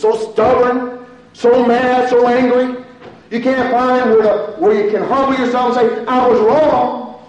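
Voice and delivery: loud, high, fervent preaching; preaching at full force. Acted. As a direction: drive the plea home to the congregation.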